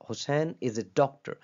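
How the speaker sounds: slow example with doctor made prominent; teacher reading a sample sentence slowly, leaning on doctor; slow classroom demo of the tonic word; stress on doctor. Shown as a demonstration. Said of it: "वो doctor किया".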